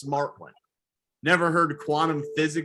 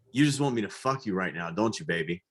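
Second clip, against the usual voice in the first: seductive murmur; 6 semitones lower, 4 dB quieter than usual. Retold or imitated. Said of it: "I'd be like".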